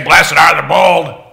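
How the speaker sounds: loud, slow, booming mock declaration; bold voice. Shown as a demonstration. Teacher showing children what Jesus didn't say, bold and booming. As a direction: belt it out boldly. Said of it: "talk in a really bold voice".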